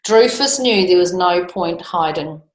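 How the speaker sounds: ordinary delivery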